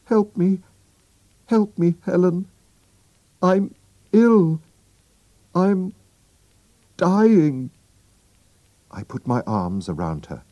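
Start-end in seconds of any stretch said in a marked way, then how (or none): 0.1-7.7 high, loud, desperate pleading; crying for help. A narrator's reading. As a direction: beg for help, terrified and weak. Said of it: "she was crying".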